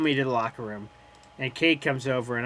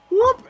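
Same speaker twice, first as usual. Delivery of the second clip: mock whoop; 6 dB louder than usual. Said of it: "take it and go".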